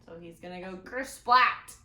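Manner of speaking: put-on lively read-aloud for kids; storybook reading. A narrator's reading. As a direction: tell the story to the children. Reads picture book aloud.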